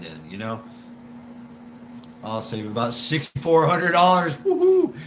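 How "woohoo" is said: high, loud whoop; excited woohoo; cheer. Real, not acted. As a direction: whoop it up over the savings. Cheering over money saved.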